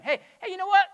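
high, loud breezy mock chirp; mock casual suggestion. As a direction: toss it off like a flippant friend. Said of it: "not a suggestion".

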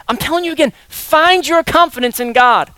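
ordinary talk

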